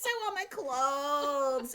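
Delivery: put-on very high, then slow, dragged-out toddler whine; whiny toddler. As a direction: complain like a fussy toddler in the heat. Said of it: "the baby goes".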